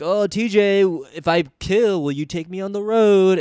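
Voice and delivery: lightly mock someone angling for a favor; mock eager pleading. Mock eager comics courting TJ.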